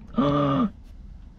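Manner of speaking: sharp high gasp of surprise; surprised gasp. Real, not acted. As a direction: gasp as it stays upright. Item unexpectedly stands up.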